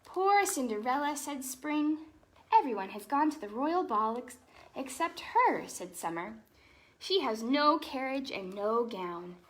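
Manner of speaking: lively storybook read-aloud; animated picture-book narration; reading to children. Acted. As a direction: read it like bedtime story time for kids.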